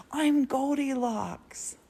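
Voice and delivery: high, slow, playful storybook voice; hammed-up high singsong announcement; Goldilocks. Joking about who used her towel, playful high character voice.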